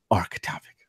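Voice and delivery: whispered, trailing low; hushed topic mention. Pretend. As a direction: drop into a mock-secret whisper. Teasing hush before the topic.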